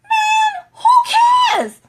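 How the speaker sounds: shrieking who cares; exasperated shout. Real, not acted. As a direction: throw up your hands and yell it. Exasperated outburst.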